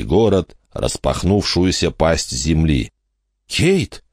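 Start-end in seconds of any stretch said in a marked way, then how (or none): 3.5-4.0 high urgent call of a name; alarmed call. Acted. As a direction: shake her awake with a shout. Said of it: "Майкл тряс её за плечи".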